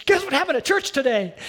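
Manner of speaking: bursting to share the news, fast; playful mock-excited churchgoer; excited gossip. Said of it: "Everybody goes home from church and says".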